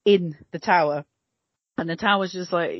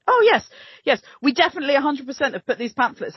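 higher, over-insistent agreement; mock official assurance; 8 semitones higher, faster than usual. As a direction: play an official hastily conceding the point. Voices the authorities' sham admission.

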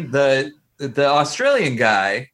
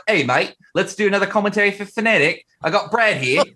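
mock Australian mate slipping into British; put-on Australian accent gone British; botched Australian accent; 3 semitones higher than usual. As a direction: imitate an Aussie pal, drifting British. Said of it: "Wait, that turned British".

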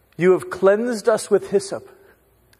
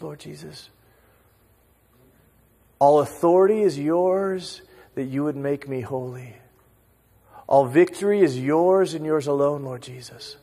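ordinary delivery

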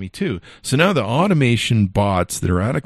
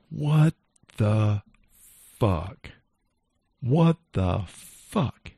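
floored, spelling out each word; stunned disbelief; 3 semitones higher, much slower than usual. Retold or imitated. Reacting to the thirty-day ban, stunned and slowed. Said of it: "I'm like".

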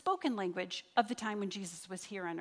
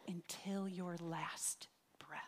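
whisper; 5 semitones lower, 9 dB quieter, slower than usual. Acted. Sermon climax, dropping voice.

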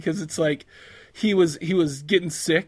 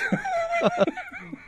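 high squealing laugh; 5 dB quieter than usual. Real, not acted. Laughing at his friend's joke, high squeal. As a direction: burst of shrill giggling.

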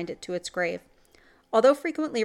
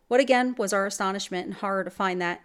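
usual speaking voice

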